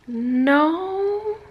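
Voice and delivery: drawn-out high, rising no; baffled denial. Retold or imitated. Dog owner answering a stranger's odd dingo question, baffled. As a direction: stretch it out, high and puzzled.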